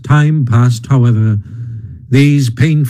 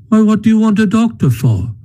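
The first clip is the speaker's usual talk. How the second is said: puzzled Rat; 9 semitones higher, faster than usual. A narrator's reading. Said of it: "inquired the rat".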